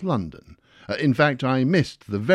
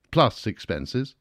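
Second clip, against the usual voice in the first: usual speaking voice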